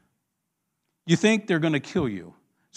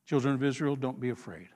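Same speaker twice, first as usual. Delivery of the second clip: low, hushed comfort; calm a frightened crowd softly; soft, settling voice of a leader; gentle reassurance; 5 semitones lower, 10 dB quieter than usual. Acted. Voices Moses calming the people.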